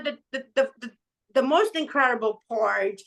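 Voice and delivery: ordinary talk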